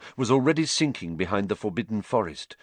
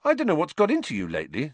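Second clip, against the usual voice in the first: high, fast disbelief at a friend; astonished, rushing the words; astounded; 8 semitones higher than usual. Acted. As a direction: blurt it out, baffled and quick.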